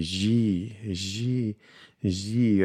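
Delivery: "G" said as a soft "zhee". Shown as a demonstration. Learner drilling an island name, "G" as zhee. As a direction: draw out "G" as zhee, with a French-style j. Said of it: "the first G is like a shili".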